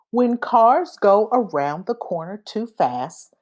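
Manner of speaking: usual speaking voice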